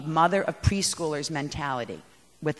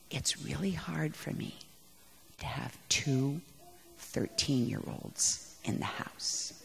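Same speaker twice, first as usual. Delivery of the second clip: whispered, slow and soft; hushed whisper; 5 semitones lower, 12 dB quieter, slower than usual. Retold or imitated. Husband whispering over the phone to his wife, hushed. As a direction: breathe it like a quiet confession. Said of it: "he calls me and says".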